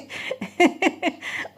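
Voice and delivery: high breathy unvoiced giggle; real laughter. Real, not acted. Mother laughing at her son's YouTuber tips, airy high giggle. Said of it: "मुझे इतनी हंसी आई ना".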